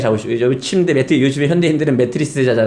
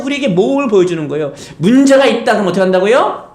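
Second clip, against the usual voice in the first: ordinary talk, nothing marked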